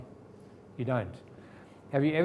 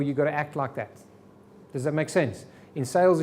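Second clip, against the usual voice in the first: usual speaking voice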